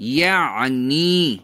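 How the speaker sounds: یعنی stretched slowly, throat squeezed in the middle; exaggerated ain. Shown as a demonstration. Teacher gives a memory aid, یعنی with exaggerated ain. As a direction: draw out یعنی, leaning on the throat sound. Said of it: "عین کو یاد کرنے کا ایک idea".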